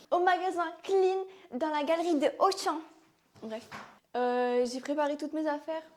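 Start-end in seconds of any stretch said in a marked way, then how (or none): 0.1-2.8 higher, louder, hammed-up retort; playful defiance. Answering a friend who mocks her accent, playful defiance. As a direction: play it up loudly, pitch raised. Resumes the hammed-up clean girl bit.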